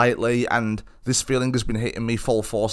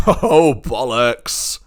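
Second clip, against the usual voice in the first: loud, drawn-out mock cinema heckle; hammed-up slow shout of a wisecrack; imitating heckler; 5 dB louder, much slower than usual. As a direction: drag it out loudly, imitating a joker in the audience. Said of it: "turns to his girlfriend and goes".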